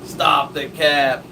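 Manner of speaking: joking slang holler; playful shout. Pretend. Repeats playful slang outburst.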